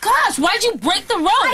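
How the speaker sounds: exasperated. Real, not acted. Exasperated sister.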